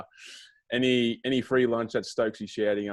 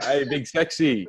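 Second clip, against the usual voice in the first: drawn-out, higher mock yell; slow, raised-voice imitation of a holler; yelled greeting; 4 semitones higher, 5 dB louder, slower than usual. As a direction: call it out like someone hollering across a track. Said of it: "I hear this little man yell out".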